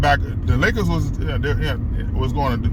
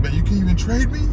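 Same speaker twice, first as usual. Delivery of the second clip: quoting Chris Paul; 9 semitones higher than usual. Retold or imitated. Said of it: "He said".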